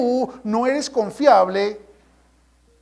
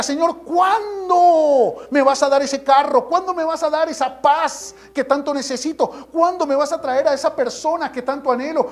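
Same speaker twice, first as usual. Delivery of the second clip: high-pitched insistent questioning; impatient plea to God; 5 semitones higher than usual. Acted. Believer listing requests to God, insistent pleading. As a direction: pile up each demand, voice held high. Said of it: "tú le haces a Dios la pregunta".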